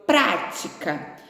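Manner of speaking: slow, stretched "prática", PRÁ-ti-ca; stress on first syllable. Shown as a demonstration. Teacher showing pupils the word "prática", slowly stressing PRÁ. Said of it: "ela recebeu o acento no pra".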